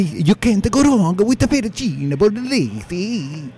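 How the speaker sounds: high, slow, exaggerated Italian accent; Italian waiter accent. Waiter approving fettuccine bolognese, thick fake Italian accent. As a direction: stretch it out in a hammed-up Italian accent. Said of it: "This is how he was talking".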